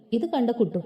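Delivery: usual speaking voice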